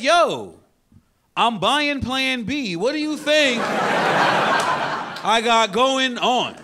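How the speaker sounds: loud, slow and high, building to a yell; frantic rant. Retold or imitated. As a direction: plead with the cashier to hurry up.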